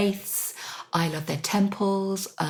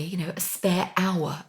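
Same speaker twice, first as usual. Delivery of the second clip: ordinary delivery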